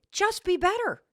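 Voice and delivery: high mock yell of the husband; husband screaming at his wife, mock yell; retold scream. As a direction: fire it at her like a final order. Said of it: "Chris did scream at me".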